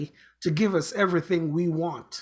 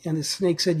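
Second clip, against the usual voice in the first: normal talking voice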